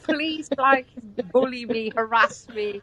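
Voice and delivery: mock pleading victim. Mimicking victim begging.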